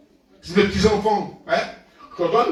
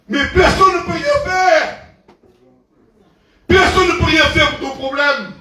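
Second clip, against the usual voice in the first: raised, insistent preaching voice; 5 semitones higher, 4 dB louder than usual. Acted. Preacher challenging the congregation, loud and insistent. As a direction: drive the question home to the congregation.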